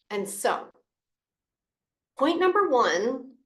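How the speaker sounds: ordinary delivery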